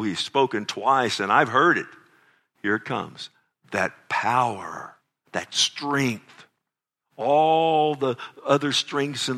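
loud, rising sermon delivery; fervent preaching. Acted. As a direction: preach it out full force to the congregation. Preacher building to full force.

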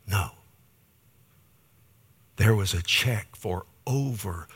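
slow, hushed reveal of each amount; awed whisper. Real, not acted. Pastor telling the church about the gift, slow hushed awe. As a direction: let the amount sink in, barely breathing.

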